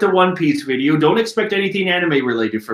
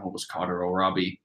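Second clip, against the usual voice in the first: Robin's voice; 9 semitones lower, 10 dB quieter, faster than usual. Acted. Robin murmurs to Robbie, low and soft. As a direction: lower and much softer, a hint of calm woman. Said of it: "then Robin's like".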